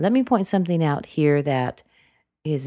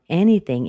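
"anything" lifted slightly, then dropped; winding down on "anything"; rise then fall; 5 dB louder than usual. Shown as a demonstration. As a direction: nudge "anything" up and let it fall. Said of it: "rise up slightly and drop down".

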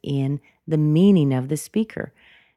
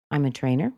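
"I'm a trainer" lifting at the end of TRAINER; light upward lilt on "trainer"; light, casual rise; much faster than usual. Shown as a demonstration. Answering what do you do, light casual rise. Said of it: "it's casual".

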